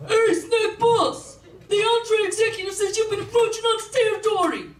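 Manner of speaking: high-pitched cartoon mafia henchman; Jersey mobster. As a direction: imitate a Jersey wiseguy reporting to the boss. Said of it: "some Jersey greaseball guy with slicked back hair".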